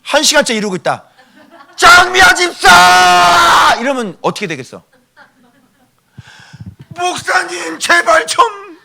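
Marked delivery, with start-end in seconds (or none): 1.8-3.8 high, loud holler of a name; crying out. Shown as a demonstration. Preacher demonstrates calling a deaconess standing nearby, crying out. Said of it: "맨날 부르짖어". 6.9-8.8 high, drawn-out mock whimper; pained pleading. Deaconess begs the pastor to stop shouting, pained pleading.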